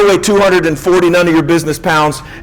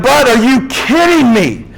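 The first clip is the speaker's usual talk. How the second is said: higher, disbelieving plea to a buddy; you can't be serious, pitched up; incredulous; 6 semitones higher, slower than usual. Retold or imitated. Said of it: "And I was like".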